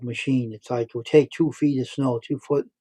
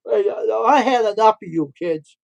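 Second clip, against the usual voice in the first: retold exasperated yell; 8 semitones higher, 5 dB louder than usual. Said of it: "That guy went".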